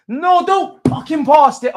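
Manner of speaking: high, loud and drawn-out protest; slow desperate cry; anguished yell. Real, not acted. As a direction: beg him not to pass. Anguished plea at the screen.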